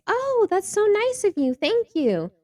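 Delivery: high, gushing mock thanks; a high mock-sweet thank you. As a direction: play the friend gushing. Acting out the friend's thanks.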